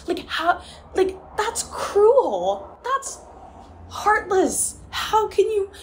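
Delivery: high, loud, real crying; breaking into tears. Real, not acted. Upset reader railing at a cruel book, breaking into tears.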